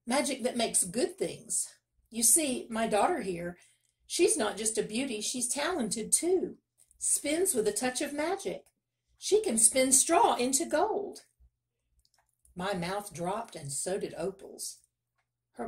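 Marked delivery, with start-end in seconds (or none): 0.1-11.3 high, eager sales pitch; eager boasting, building to a loud, high finish; boastful miller. A narrator's reading. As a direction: talk up your daughter to a king, rising higher. Miller boasts of his daughter.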